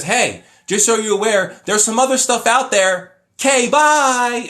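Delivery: high, loud, breezy brush-off voice; dismissive mock-cheerful farewell; mocking paraphrase. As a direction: toss it off like a careless shrug. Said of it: "It just says".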